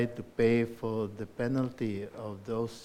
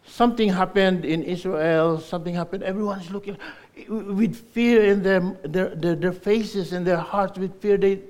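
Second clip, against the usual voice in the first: loud, high preaching voice; rousing, alarmed sermon delivery; preaching at full force; 7 semitones higher, 7 dB louder than usual. Acted. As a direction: proclaim it to the whole hall.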